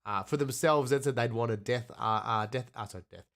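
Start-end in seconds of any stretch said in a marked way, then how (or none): none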